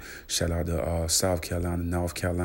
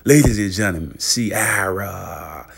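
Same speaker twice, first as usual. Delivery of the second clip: showman announcement; 2 semitones higher, 8 dB louder, slower than usual. Pretend. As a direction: present her like a ring announcer. Announcing the next celebrity.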